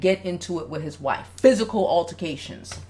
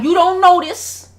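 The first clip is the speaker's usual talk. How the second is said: high, slow retold yell; 9 semitones higher, 7 dB louder than usual. Father confronting his wife, retold yell. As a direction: press it like an exasperated dad. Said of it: "He was like".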